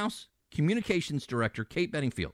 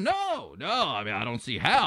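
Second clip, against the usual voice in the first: mocking denial; 3 semitones lower than usual. Host mocks the president's denial.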